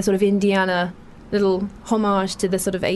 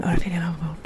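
softly whispered, relishing the name; savouring whisper; 4 semitones lower, 5 dB quieter, faster than usual. Real, not acted. Host savours actress's name to her guest, savouring whisper.

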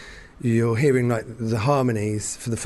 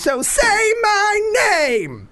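soaring high, slow shouted rock-star demand; 5 dB louder, much slower than usual. Retold or imitated. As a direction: belt it way up like a showman. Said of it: "he goes".

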